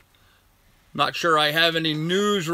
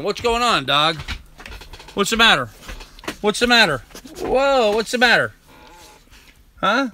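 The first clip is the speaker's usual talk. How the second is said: higher, excited pet talk for the dog; fussing over the dog; 4 semitones higher than usual. Real, not acted. Streamer talking to his excited dog, higher pet voice. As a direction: coo over the jumpy dog with raised pitch.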